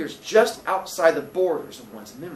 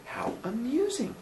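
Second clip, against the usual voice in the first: high, soft, slow musing; amused landlady; 9 semitones higher, 7 dB quieter, slower than usual. Acted. Landlady responds to Billy's puzzle, amused. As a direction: say it softly, pitched up and drawn out. Landlady's reply acted in story.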